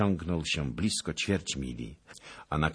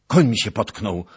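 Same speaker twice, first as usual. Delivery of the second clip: loud, raised cry of alarm; alarmed shout; 5 semitones higher, 10 dB louder than usual. A narrator's reading. Nobleman to knight as his horse stumbles, loud shout. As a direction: call out the trouble to your companion. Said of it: "krzyknął Zagloba".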